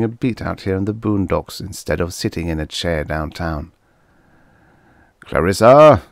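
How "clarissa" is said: a booming, much louder call of the name; voice like thunder. A narrator's reading. Policeman booming at the girl, loud and commanding. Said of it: "in a voice like thunder".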